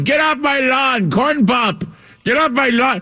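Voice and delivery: put-on grumpy old man yelling off the porch, high-pitched; Joe Biden. Host mocking Biden as old man on lawn, cranky old-man voice. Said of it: "He sounds like that old man going".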